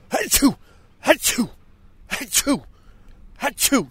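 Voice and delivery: sharp high sneezes in a row; sneezing. Acted. Allergic to the dog, he sneezes theatrically.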